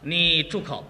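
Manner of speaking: low-pitched command; stern husband. Acted. Husband silences his crying wife, stern. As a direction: put on a firm, manly authority.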